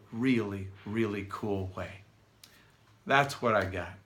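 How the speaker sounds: winded, breathy trailing finish; out of breath. Real, not acted. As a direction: speak as if short of air. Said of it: "So I'm a little bit out of breath".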